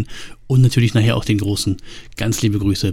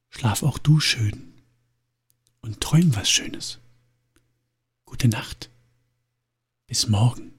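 soft goodnight; 7 dB quieter than usual. Real, not acted. Wishing listener goodnight.

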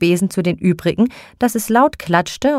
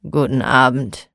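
low, sullen mutter; grumpy grumble; 5 semitones lower than usual. Acted. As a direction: grumble it without looking up. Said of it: "Der Mann brummte mürrisch".

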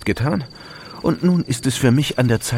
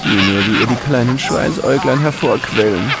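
gloating. Acted. Killer gloats over hanged victim.

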